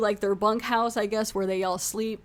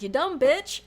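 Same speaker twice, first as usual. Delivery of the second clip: mock outraged yell, pitched up; retold yell; 6 semitones higher, slower than usual. Viewer yelling at Liz on screen, retold yell. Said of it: "I literally yelled out".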